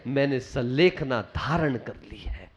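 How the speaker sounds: dying daughter whispering to her father. Acted. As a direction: breathe the vow out in a whisper. Dying daughter's final resolve.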